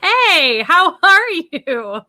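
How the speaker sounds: delighted hello to first viewer; excited greeting. Real, not acted. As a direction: greet them brightly.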